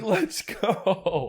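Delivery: high-pitched cheer, held back from a full yell; retold hype cheer. Retold celebratory cheer repeated.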